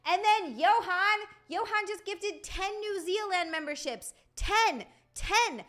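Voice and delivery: can't believe the gift, delighted; thanking a viewer for ten gifts, thrilled. Real, not acted. Thrilled at ten gifted memberships.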